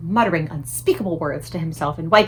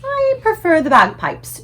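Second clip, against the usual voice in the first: amused Queen; 8 semitones higher, 6 dB louder, slower than usual. A narrator's reading.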